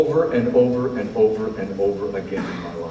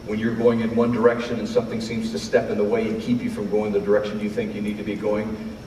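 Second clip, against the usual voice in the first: ordinary talk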